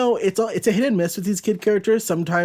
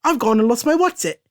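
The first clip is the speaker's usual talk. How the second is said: higher-pitched put-on English accent; 8 semitones higher, 3 dB louder than usual. English woman hailing a sailor for a boost, hammed-up English accent. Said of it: "I get to bust out an accent".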